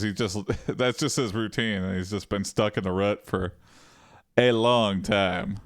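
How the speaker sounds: ordinary talk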